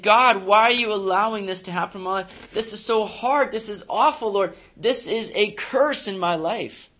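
lightly acted pleading complaint; distressed prayer. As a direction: cry out to God in mild despair. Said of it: "where we think".

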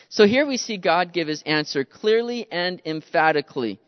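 ordinary talk

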